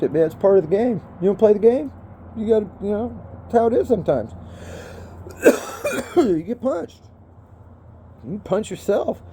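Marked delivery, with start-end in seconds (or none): 4.4-6.1 hacking smoker's cough; ragged coughing fit; harsh cough. Real, not acted. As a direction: cough it out hard. Coughing after hit.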